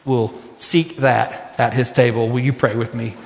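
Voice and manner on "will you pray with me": ordinary talk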